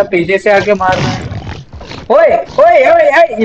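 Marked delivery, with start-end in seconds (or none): 2.1-3.4 angry yelling. Real, not acted. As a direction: yell at the mob that hit you from behind. Calling the attacking mob to fight.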